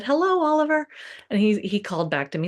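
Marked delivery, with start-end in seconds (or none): 0.0-0.9 sing-song call, much higher; calling to her son. Retold or imitated. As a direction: call out gently down the hall. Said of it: "and I said".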